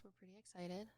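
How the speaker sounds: very soft, awkward announcement. Real, not acted. Host awkwardly announcing a first podcast, very softly. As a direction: trail into an awkward, hushed delivery. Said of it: "Say it in the most awkward way possible".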